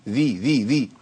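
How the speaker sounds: ذي repeated with a voiced ذ and no breath; isolated letter drill. Shown as a demonstration. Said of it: "أيوة كده يا أخي".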